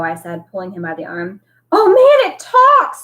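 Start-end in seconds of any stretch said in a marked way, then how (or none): 1.7-3.0 high, loud jeering scream; mocking scream. A narrator's reading. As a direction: scream it out to taunt him. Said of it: "screamed Eddie".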